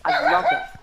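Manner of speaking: high whispered squeal of delight; excited squeal. Real, not acted. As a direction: squeak it out with glee.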